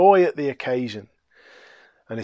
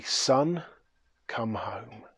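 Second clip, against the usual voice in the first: much softer, hushed and loving; tender welcome; 6 dB quieter, much slower than usual. Acted. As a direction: call him home gently. Father welcomes son home.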